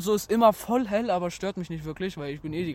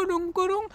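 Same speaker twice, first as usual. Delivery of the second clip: bell sounds; 12 semitones higher than usual. Retold or imitated. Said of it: "hörst du so Glocken".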